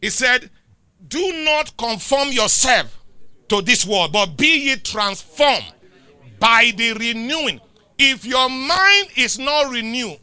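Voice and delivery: loud, slow, drawn-out preacher cadence; preaching. Real, not acted. Preacher driving the congregation toward the closing scripture, loud and slow. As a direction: preach it over the music.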